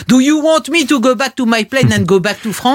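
Chirac. Retold or imitated. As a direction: protest like an offended French president abroad. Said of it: "Chirac à Jérusalem".

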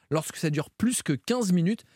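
usual speaking voice

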